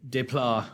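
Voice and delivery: Desplat as "day-plah", S and T silent; French pronunciation. Shown as a demonstration. Retrying the composer's French name, [name] said "day-plah". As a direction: drop the S and final T in Desplat. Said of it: "I think it's French".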